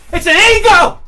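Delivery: high, very loud yelled punchline; explosive shout. Acted. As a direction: bellow it out at a high pitch. Punchline yelled about ego.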